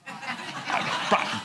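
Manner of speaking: breathy chuckle. Real, not acted. Preacher laughs at own joke.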